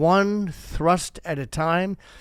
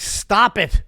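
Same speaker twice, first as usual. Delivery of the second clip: higher, mock-stern order; mock scolding; 4 semitones higher, 4 dB louder than usual. Scolding his misbehaving penis, mock-stern. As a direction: scold it in exasperation, playfully.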